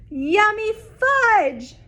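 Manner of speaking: put-on high, slow little-kid delight; a small boy savoring the fudge; little brother. Little brother voiced in story.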